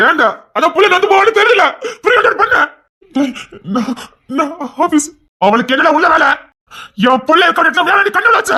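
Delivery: high, loud furious scolding; husband yelling at his friend in fury; angry shouting. Acted. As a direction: rage at the friend who caused this. Husband berating friend angrily.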